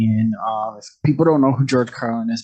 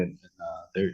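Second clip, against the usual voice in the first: usual speaking voice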